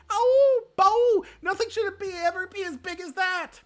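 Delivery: shrieking, very high and loud cries; panicked screaming. A narrator's reading. Feline screaming in terror at the giant stag. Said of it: "She continued to scream".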